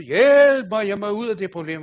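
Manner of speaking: loud, high plea for help; desperate cry to God. Retold or imitated. Man prays aloud to God for help, shouting. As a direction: shout the prayer out in despair. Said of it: "han bad og råbte til Gud".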